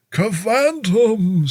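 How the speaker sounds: high, slow spooky exclamation; spooky Halloween voice. Shown as a demonstration. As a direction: draw it out like a Halloween ghoul. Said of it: "Sounds like something for, um, Halloween".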